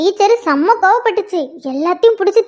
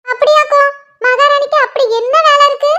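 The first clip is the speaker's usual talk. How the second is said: mocking the little queen; lightly voiced scornful mum; sarcastic mother; 6 semitones higher than usual. Mother mocking her daughter as a queen, sarcastic.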